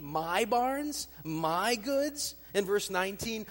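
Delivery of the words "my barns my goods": "My" pushed up high and loud with a questioning rise; stress on my. Shown as a demonstration. Preacher stressing Luke's wording, incredulous stress on "My". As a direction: lean on "My" as if in disbelief. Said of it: "You might have heard it in my inflection".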